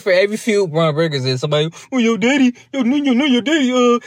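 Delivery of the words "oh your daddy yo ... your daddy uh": mock taunt. Mocking a rival's taunts.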